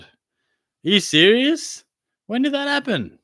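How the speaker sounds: real shock at news of the closed road; disbelief. Real, not acted. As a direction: stretch out serious in shock. Hearing a road is shut.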